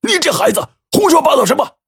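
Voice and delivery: high-pitched indignant scolding; angry rebuke. A narrator's reading. Father who just slapped his son, scolding angrily. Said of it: "怒斥道".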